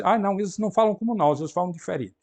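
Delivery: imitating people remarking on outsiders' speech; mock complaint. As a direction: toss off a quoted objection fast. Mimics others' complaint about accent.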